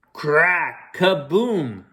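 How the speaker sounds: usual speaking voice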